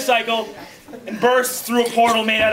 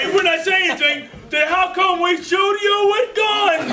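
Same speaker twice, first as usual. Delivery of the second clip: higher-pitched silly villain voice, stretched at the end; mock protest; 7 semitones higher than usual. Villains ask why they shoot you with guns, mock protest. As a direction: draw out the indignant question. Villains' taunt, acted by game master.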